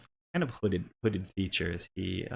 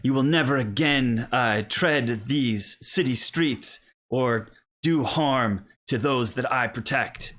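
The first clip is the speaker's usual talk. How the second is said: loud, higher play-knight proclamation; Cole; 5 semitones higher, 5 dB louder, slower than usual. Acted. Young knight warns the pretend monster, loud and grandly. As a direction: declaim slowly, as a child hero would. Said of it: "kind of come across, uh, loudly".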